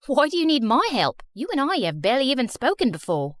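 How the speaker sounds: put-on puzzled question in British accent. Student questioning popular girl who wants help, British accent. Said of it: "talking in a British accent all the time".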